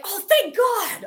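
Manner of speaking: high, loud, slow "thank God"; mock relieved cry, drawn out; relieved squeal. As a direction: burst out in relief, stretched. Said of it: "I was like".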